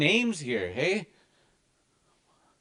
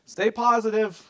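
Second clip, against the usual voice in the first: higher, lightly voiced elder; elder's encouragement; 8 semitones higher, 4 dB louder than usual. Elder telling the teacher to stay positive, mock voice. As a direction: cheer him on like an elder. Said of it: "telling me".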